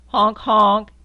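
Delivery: loud, blaring honks; loud horn honk. Acted. Reading to a child, honking the jeep horn loudly.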